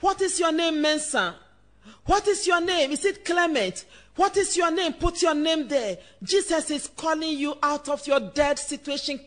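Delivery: usual speaking voice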